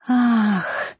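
short high cried-out moan; a sudden lightly voiced gasping moan. A narrator's reading. Sia with Noan in the car scene, short high moan. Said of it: "Её стон".

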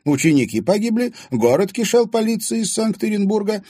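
put-on high, loud old woman's voice; old woman telling the past; reminiscing old woman. A narrator's reading. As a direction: recall old times.

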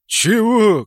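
loud, high, startled what; baffled. Acted. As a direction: blurt it out, thrown off. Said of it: "Кракен даже растерялся".

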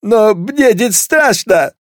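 very high, congested cadet; squeezed, high voice with a head cold; stuffed-nose voice. A narrator's reading. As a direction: talk through a badly blocked nose. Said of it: "Насморк у неё?".

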